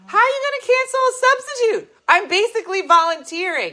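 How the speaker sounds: high, loud disbelief; outraged at being canceled; indignant. Real, not acted.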